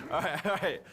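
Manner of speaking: high, helpless laugh that fades into words; laughing so hard the voice goes up; burst of laughter. Real, not acted. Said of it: "Was it really that funny?".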